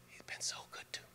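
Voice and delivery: barely voiced, tears in the throat; a whisper through a breaking voice; choked whisper. Retelling grieving friend's words, choked whisper. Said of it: "they know their voice will break".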